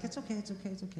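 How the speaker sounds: put-on calm a crying child; gentle hush of a father; soothing. Dad soothing crying son.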